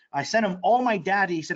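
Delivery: usual speaking voice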